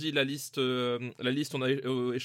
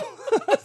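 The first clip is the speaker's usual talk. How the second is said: high laugh; 5 dB louder than usual. Real, not acted. Hearty laugh at the joke.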